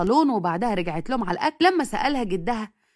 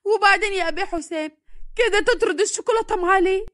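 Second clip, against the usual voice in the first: put-on shouting girl; 10 semitones higher than usual. A narrator's reading. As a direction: wail over the lost chocolate. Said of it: "وهي بتزعق وبتقول".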